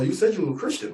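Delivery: ordinary talk, nothing marked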